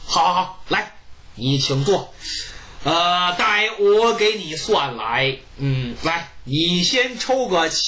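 put-on low, slow, deliberate old man; low unhurried fortune-teller voice. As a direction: drawl it out like a sly old diviner. Jiang Ziya voiced as fortune teller.

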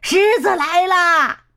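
drawn-out high call, lion is coming; slow shout. A narrator's reading. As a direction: stretch out a warning cry. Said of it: "两个家伙大叫".

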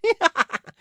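high, loud burst of laughter; big hearty laugh. A narrator's reading. Wukong laughs on reading the invitation, hearty laugh. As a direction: laugh out loud as the Monkey King. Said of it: "哈哈大笑".

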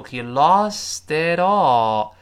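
slow, loud demo linking "lost it all"; teacher showing how "lost it all" links; linked pronunciation. Shown as a demonstration. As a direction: stretch "lost it all" out as one joined phrase. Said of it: "注意这个连读".

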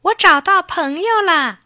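high, loud happy cry of discovery; boy shouting with joy into the mountains; joyful shout. A narrator's reading. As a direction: call out with delight across the hills. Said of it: "他高兴地大声欢呼起来".

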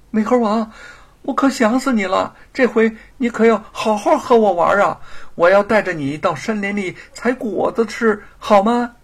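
put-on high, loud, eager little boy; a child begging his monkey to play; excited child. 孩子对猴子欢喜说话. (孩子对美猴王兴奋地高声说话)

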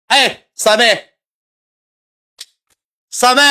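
high, drawn-out call across the room; slow, high, stretched-out summons to the hostess; long high call for the hostess. Retold or imitated.

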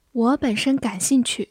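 usual speaking voice

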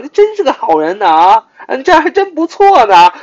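very high, loud mock praise; sarcastic. Shown as a demonstration. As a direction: gush with obvious insincerity. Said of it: "讽刺的啊，sarcastically啊". (夸张地阴阳怪气)

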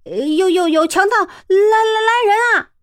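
high panicked shouting, crying out; screaming for help. Acted. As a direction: yell for help in terror. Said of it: "向冲天也鬼叫了起来". (惊慌地大叫救命)

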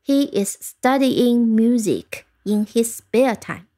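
slow, evenly spaced words; model sentence reading. Shown as a demonstration. Teacher giving learners an example sentence, slow model reading. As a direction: read it out slowly for learners. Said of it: "再听一遍这句句子".

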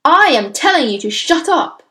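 loud, high command; furious, emphatic shutdown; roared order. A narrator's reading. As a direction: roar her into silence. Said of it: "the Trunchbull roared".

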